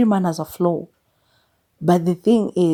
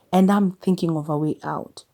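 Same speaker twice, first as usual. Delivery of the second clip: normal talking voice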